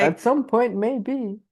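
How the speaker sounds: high, loud mock villain tease; wild unhinged ranting. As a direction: tease about world domination. Jokes about world domination.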